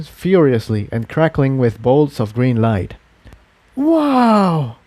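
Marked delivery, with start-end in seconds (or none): 3.8-4.8 high, thrilled exclamation; amazed pony. Acted. Pink unicorn marvels at the fireball, amazed. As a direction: gasp of wonder. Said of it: "said a voice from his right".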